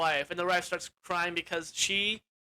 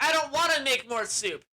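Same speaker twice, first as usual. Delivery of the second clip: higher-pitched mock complaint; whiny refusal; 7 semitones higher, 3 dB louder than usual. Wife fed up with the leader, complaining. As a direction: play a fed-up wife protesting.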